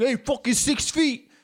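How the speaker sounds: high-pitched, drawn-out angry holler; mock confrontational shout; confrontational yell. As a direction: holler it like an aggressive jerk. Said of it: "I'm not gonna be like".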